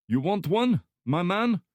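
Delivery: put-on high, loud thick Slavic accent; heavy Slavic accent. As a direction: Slavic thug voice, friendly menace. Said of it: "speaking in a heavy Slavic accent".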